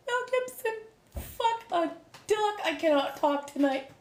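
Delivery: high, squeaky voice through laughter; laughing exasperation. Real, not acted. Streamer owning up to a name mix-up, laughing high.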